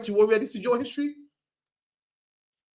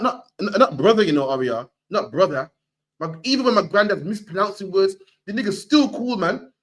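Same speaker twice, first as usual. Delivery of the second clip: ordinary talk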